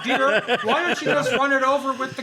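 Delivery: mock-nagging farm wife, half laughing; farmer's wife.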